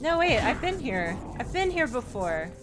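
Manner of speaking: normal talking voice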